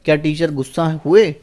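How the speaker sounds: normal talking voice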